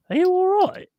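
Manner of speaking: high, loud incredulous question; alarmed instructor. Retold or imitated. Driving instructor to his raging pupil, alarmed high-pitched query. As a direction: imitate a startled driving instructor.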